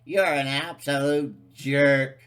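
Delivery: high, loud sneering critic voice; mock critic. Mocking a skeptic who scoffs at flat Earth, loud sneer.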